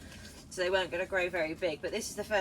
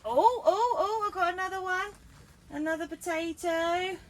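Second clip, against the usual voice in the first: excited; 9 semitones higher, 4 dB louder than usual. Real, not acted. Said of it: "this is exciting".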